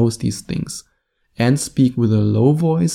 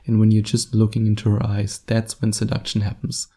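ordinary talk

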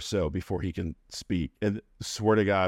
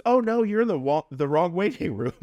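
high mock nurse; 10 semitones higher, 4 dB louder than usual. Nurse voiced in retold story.

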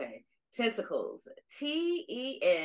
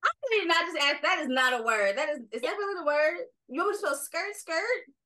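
high, disbelieving protest; can't believe this is the word; incredulous; 8 semitones higher, much faster than usual. Real, not acted. Outraged at silly word.

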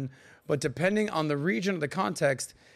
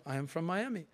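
softly voiced fake French accent; 7 dB quieter than usual. Tourist insisting to a French girl, French accent. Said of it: "Why do you talk like this?".

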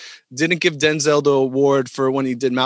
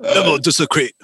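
Master Splinter; 4 semitones higher than usual. Acted. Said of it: "the racist ass Master Splinter".

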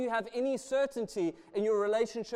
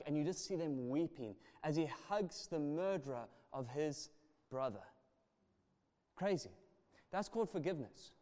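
choked up; 6 semitones lower, 8 dB quieter than usual. Real, not acted. Moved retelling of forgiveness.

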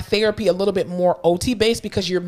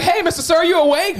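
higher-pitched call to a patient; retold rousing call; 10 semitones higher, 4 dB louder than usual. Therapist checking on a sedated patient, high rousing call. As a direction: call out high to rouse someone.

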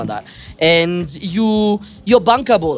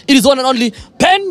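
hype announcer shout; 8 semitones higher, faster than usual. Acted. Host announces the guest.

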